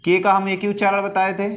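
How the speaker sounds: normal talking voice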